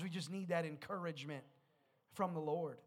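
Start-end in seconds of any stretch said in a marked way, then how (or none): none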